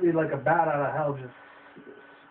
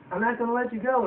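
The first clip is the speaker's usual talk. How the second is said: a hint higher, mock firm; lightly raised refusal; 7 semitones higher than usual. Oz refusing to let the girl come, lightly voiced. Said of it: "He's like".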